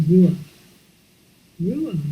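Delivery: ruach with a breathy guttural ch at the end; Hebrew ruach. Shown as a demonstration. Teacher introducing the Hebrew word, pronouncing it clearly. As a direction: say ruach as in Hebrew, rolling out of the throat. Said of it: "It's a Hebrew word".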